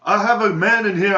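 high, loud cop impression; mocking cop voice. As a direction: squeal it out like a panicked cop. Cop on phone, laughing retelling.

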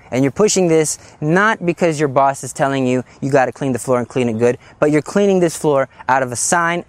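ordinary talk

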